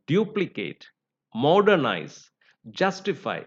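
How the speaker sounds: "duplicate, modernize, justify" stressed on DU-, MOD-, JUS-; stress on first syllable. Shown as a demonstration. Teacher showing the rule, stress third from the end. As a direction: hit the opening syllable of "duplicate", "modernize", "justify", then fall. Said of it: "third syllable from the end".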